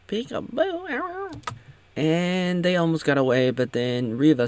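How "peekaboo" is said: very high, drawn-out squeaky peekaboo; silly peekaboo. Pretend. Leia pops out of the jacket, silly drawn-out peekaboo. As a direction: stretch it out in a silly falsetto. Mimes Leia hidden under coat.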